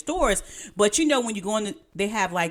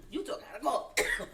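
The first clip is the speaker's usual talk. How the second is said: after coughing; 3 semitones higher, 9 dB quieter, slower than usual. Real, not acted. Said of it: "coughing up a lung right now".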